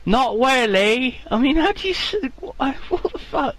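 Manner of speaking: high fake Chinese accent, breaking into a laugh; a put-on Chinese accent that cracks up. Pretend farmer answers the host, fake Chinese accent. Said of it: "I can't do the accent".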